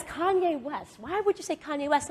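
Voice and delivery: high-pitched, imitating an incredulous interviewer; puzzled double-take at the name; baffled questioning. Acting out interviewer's baffled reaction.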